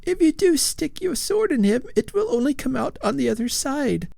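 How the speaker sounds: high, fretful ghost lady voice; a prim lady ghost fussing at her husband; ghostly old lady. A narrator's reading. Lady Follett's voice.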